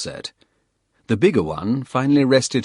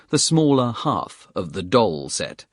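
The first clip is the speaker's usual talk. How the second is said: ordinary delivery, nothing marked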